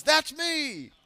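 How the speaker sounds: put-on high, excited kid's shout of "That's me!"; a child yelling out his win. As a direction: jump up like a kid who just won. Kid jumps up winning raffle.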